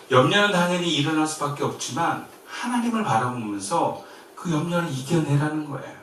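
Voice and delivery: usual speaking voice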